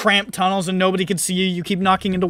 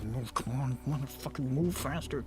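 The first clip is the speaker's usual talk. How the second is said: impatient mutter; 5 semitones lower, 14 dB quieter than usual. Retold or imitated. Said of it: "you're like".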